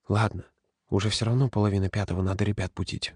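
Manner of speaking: soft, confidential undertone; hushed. A narrator's reading. Boy planning with friend at dawn, hushed. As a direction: keep it down near the sleepers. Hushed talk beside sleeping children.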